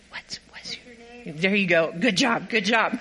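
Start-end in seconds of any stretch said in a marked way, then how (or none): 0.1-1.4 put-on whispered prompt for the child; coach's whispered cue to a young actor. Shown as a demonstration. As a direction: breathe the line softly so only he hears. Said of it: "Ask him".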